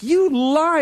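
high-pitched accusation; mock denunciation. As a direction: accuse with hammed-up outrage.

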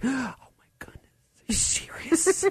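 gasped stage whisper; ridiculous disbelief. Retold or imitated. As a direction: whisper it like a hammed-up gullible victim. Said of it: "And I was ridiculous".

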